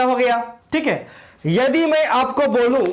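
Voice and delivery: ordinary talk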